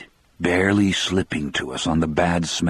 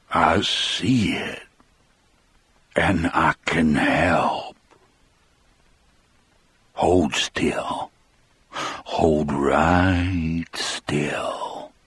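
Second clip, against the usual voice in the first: calm, reassuring; much slower than usual. A narrator's reading. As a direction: soothe her into stillness. John calmly tells her hold still.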